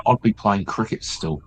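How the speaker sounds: ordinary talk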